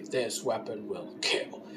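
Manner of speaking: a slow, ominous judge's pronouncement, dropped low; low, slow announcer voice. Retold or imitated. Judge announcing the kill test to the contestant, low and slow. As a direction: deliver it low and slow like a grim verdict. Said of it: "a person say".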